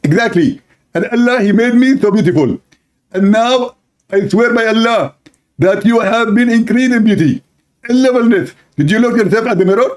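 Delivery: husband preening about heaven's beauty. Acted. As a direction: swear he's beautiful.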